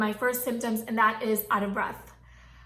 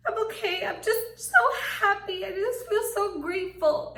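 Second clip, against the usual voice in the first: high, tearful happy voice; mock-weepy and overjoyed, pitched way up; laughing and crying; 9 semitones higher than usual. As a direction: act out happy tears, half laughing. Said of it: "I was, like, laughing and crying".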